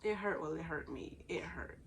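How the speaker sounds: ordinary talk, nothing marked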